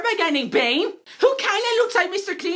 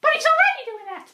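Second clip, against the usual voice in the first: high, exasperated protest; exasperated Catwoman; 9 semitones higher than usual. Acted. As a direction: whine it high. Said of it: "Catwoman is like".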